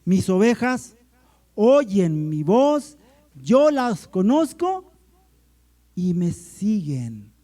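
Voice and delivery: loud, slow proclamation, phrase by phrase; emphatic scripture reading. Shown as a demonstration. Pastor reading John to the church, loud and emphatic.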